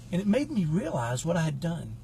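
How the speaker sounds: ordinary talk, nothing marked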